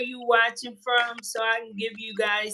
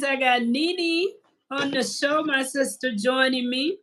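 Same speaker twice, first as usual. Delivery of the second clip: ordinary talk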